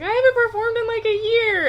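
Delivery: high-pitched mock excuse; put-on high, loud plea from a performer; imitated singer's excuse. Singer excusing himself to the crowd, mock pleading.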